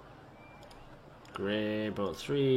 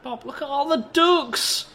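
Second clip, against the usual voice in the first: excited squeal; 14 semitones higher, 6 dB louder than usual. Real, not acted. Spotting ducks in the park.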